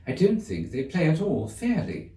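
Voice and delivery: put-on a young girl grumbling about the game; complaining Alice. A narrator's reading. Girl complains to the cat about the croquet game, whiny. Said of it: "Alice began in rather a complaining tone".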